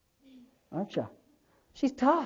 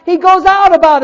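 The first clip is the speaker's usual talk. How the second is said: high, loud, hammering sermon; fired-up preaching; 9 semitones higher, 10 dB louder than usual. Real, not acted. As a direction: preach hard, punching the key phrases. Preaches at full force.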